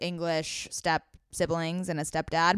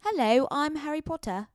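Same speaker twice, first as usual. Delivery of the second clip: higher posh English Harry Potter voice; English accent; 6 semitones higher, 3 dB quieter than usual. Retold or imitated. Sister doing her English Harry Potter impression, higher pitched. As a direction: play a young English wizard, a bit higher. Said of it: "my other one is like".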